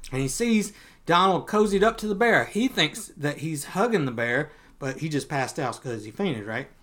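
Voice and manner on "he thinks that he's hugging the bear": plain reading voice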